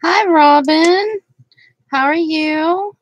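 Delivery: cheery hello to a chat viewer; sing-song greeting. Real, not acted. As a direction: welcome her brightly.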